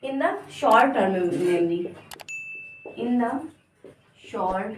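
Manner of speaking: slow, word by word dictation. Shown as a demonstration. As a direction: spell it out slowly for notes. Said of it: "teacher dictates slowly, word by word". (धीमे-धीमे लिखवाते हुए)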